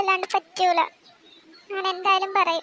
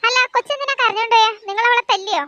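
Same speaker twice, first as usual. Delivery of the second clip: higher anxious mother voice, questioning her husband; a fretting mother begging for answers; worried mother; 4 semitones higher, 4 dB louder than usual. Acted. Mother asks husband why daughter cries, worried higher voice.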